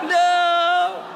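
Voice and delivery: shouted high and loud no; a son's hammed-up wail of no; anguished shout. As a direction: cry out in mock despair. Grief at being sold.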